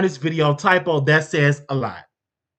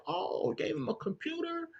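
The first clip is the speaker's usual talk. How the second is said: high, hushed disbelieving echo; soft stunned question; incredulous; 8 semitones higher, 18 dB quieter than usual. Retold or imitated. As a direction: repeat it back in quiet disbelief. Incredulous reaction to Lady T's claim.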